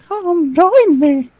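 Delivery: slow, drawn-out eerie invitation; drawn-out mock-sinister Mickey; slow creepy Mickey. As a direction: stretch "Come join me" out slowly. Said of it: "I used to do Mickey Mouse impressions".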